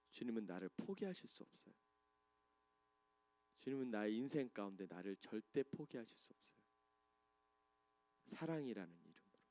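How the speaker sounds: quiet heartfelt promise of God's love; tender preaching. Acted. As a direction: reassure the congregation gently. Soft sermon close.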